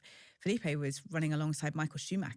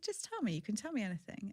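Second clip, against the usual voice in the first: high, softer coaxing plea; coaxing mother; 5 semitones higher, 4 dB quieter than usual. Retold or imitated. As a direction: gently urge a shy child to speak up. Mother coaxes hesitant son.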